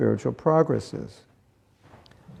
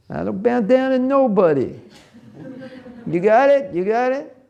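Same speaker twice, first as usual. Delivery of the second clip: high, tough New York street voice; brash New Yorker, pitched way up; New York accent; 11 semitones higher than usual. Shown as a demonstration. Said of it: "Should I say it in a New York accent?".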